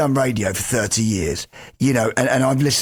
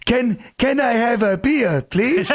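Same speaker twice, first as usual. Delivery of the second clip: mock Brit-abroad Spanish accent, pitched much higher and slowed down; broken English Spanish accent; 11 semitones higher, slower than usual. Brit abroad ordering a beer in Spain, hammed-up broken English Spanish accent. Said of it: "say it in a broken English Spanish accent".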